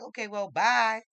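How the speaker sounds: loud, high send-off; breezy bluff. Retold or imitated. Adult calls the leaving boy's bluff, loud breezy goodbye. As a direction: call out a carefree goodbye.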